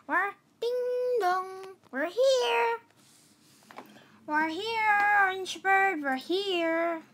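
high, drawn-out call at the door. Pretend. Friends announce themselves to Orange Bird, high playful calling.

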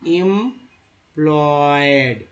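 "Employed" stretched very slowly, part by part; slow sounding-out. Shown as a demonstration. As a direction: teach "employed" with each syllable drawn long. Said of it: "जैसे ENT को एंट बोलते हैं". ("Employed" बहुत खींचकर, हिस्सों में)

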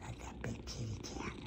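softest whisper. Real, not acted. Owner whispering to her sleeping cat, whisper. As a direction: barely breathe it.

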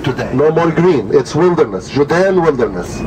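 ordinary talk

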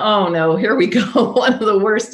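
exclaimed inner groan of "oh no"; here-we-go-again exasperation, half in jest; mock dread. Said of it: "So I thought".

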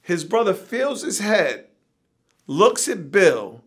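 ordinary delivery

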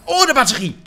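high, loud cry of discovery; excited exclamation. Real, not acted. Player finding a battery, excited high exclamation. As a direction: burst out, thrilled at a find. Delighted find of an item.

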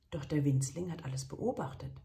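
plain reading voice

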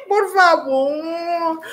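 slow plea through laughter; helpless giggling. Real, not acted. Host pleading with chat that makes her laugh, helpless giggling. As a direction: lose composure and beg, drawn out. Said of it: "ya me río".

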